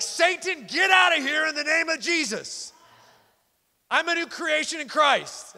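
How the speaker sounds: high, loud rebuke thrown out a car window; half-shouting like a crazy man, reenacted; yelling at Satan. New believer rebuking Satan from his car, high loud yell. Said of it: "I'd scream it out my window like a crazy man".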